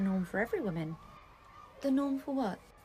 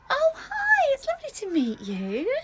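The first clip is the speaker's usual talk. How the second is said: gushing greeting; 13 semitones higher, 10 dB louder, slower than usual. Acted. Fake-bright greeting to newcomer.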